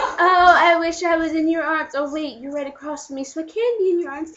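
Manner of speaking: high excited swooning; mock gushing girlfriend. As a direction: gush over him theatrically. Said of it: "she's like".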